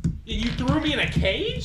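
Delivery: loud disbelieving challenge; indignant question. Acted. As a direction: demand an answer, affronted. Caged character confronts captors.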